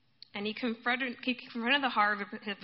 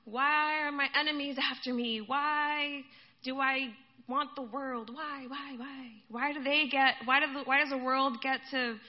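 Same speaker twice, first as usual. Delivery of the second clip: lamenting complaint; 3 semitones higher than usual. Acted. Psalmist asks God why the faithful have nothing, lamenting. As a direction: wail the complaint, acted out. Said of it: "laments and complaints to God".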